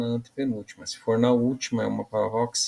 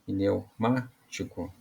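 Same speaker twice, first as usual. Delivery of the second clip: "pneumático" slow and softer, MÁ set apart; stress on MÁ; 5 dB quieter, much slower than usual. Shown as a demonstration. Teacher repeating the strongest syllable for pupils, "pneumático" slowly with stressed MÁ. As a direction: draw out "pneumático", leaning on the MÁ. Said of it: "a sílaba mais forte é o má".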